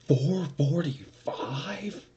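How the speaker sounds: high, slow, stretched-out shock; "Four forty-five?" drawn out high in disbelief; can't believe the time. Retold or imitated. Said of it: "I was so upset".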